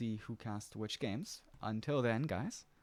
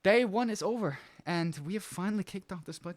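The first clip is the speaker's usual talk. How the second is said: excited; 8 semitones higher, 5 dB louder than usual. Real, not acted. Caster opening the sign-off.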